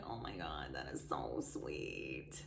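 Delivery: breathless hushed whisper of being touched; whispered awe. Retold or imitated. Hushed whisper to her friend's sweet text. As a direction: whisper it as if moved to tears.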